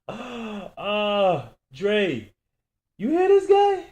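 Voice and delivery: very high, loud mocking call; laughing yell. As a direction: holler it to your partner, amused. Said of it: "and yelled out".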